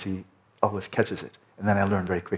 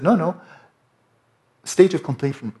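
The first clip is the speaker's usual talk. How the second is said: a lightly voiced teacher, higher and louder; imitating His Holiness; 8 semitones higher, 8 dB louder than usual. His Holiness explaining advanced practice, lightly mimicked. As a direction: ease into his firm correction. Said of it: "And he said".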